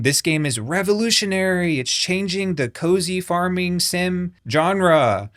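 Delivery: lightly hammed-up starry-eyed reviewer; mocking gushing quote. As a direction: parody her overexcited praise. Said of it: "She was saying".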